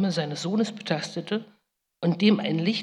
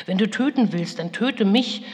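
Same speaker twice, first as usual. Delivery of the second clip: raised, louder pleading; imploring; 4 semitones higher, 6 dB louder than usual. Retold or imitated. Mother imploring her furious son Esau, raised voice. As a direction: beg him to turn his hate on you. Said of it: "beschwor ich ihn".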